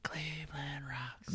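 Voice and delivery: soft breathy whisper of the chant. Pretend. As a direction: breathe the words out quietly.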